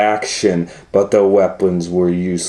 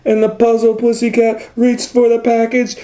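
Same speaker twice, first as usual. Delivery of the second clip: rising excitement; 10 semitones higher than usual. A narrator's reading. Narrator building up to the cat's fall, climbing higher. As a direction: pitch climbing steadily higher line by line.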